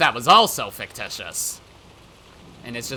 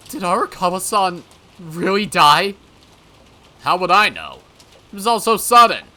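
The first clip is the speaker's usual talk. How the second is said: louder, agitated protest; 6 semitones higher, 10 dB louder than usual. Acted. Arguing over whether Arakawa-san died, agitated. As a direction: blurt it out, rattled. Acted alarmed talk about a death.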